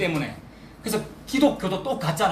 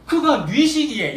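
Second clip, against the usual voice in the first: awed question; 8 semitones higher, 5 dB louder, slower than usual. Acted. Preacher voices awed disciples' question.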